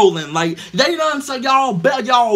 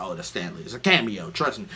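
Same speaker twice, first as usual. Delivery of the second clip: much lower, softer, smug explainer; know-it-all; 12 semitones lower, 7 dB quieter than usual. Retold or imitated. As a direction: lay it on like the guy who knows everything.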